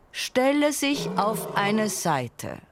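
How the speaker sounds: high, slow royal order; stately high-pitched command; announcing lion king. A narrator's reading. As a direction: direct the crowd with drawn-out words.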